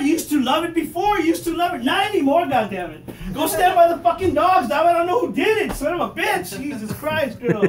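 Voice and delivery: high, loud exasperated ranting; mock angry yell. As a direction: rant at her like a fed-up husband. Arguing with wife about farts.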